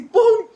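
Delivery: loud, high "pum" of a door flung open; imitated bang. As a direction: punch out a sudden slam noise. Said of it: "abrí la puerta así".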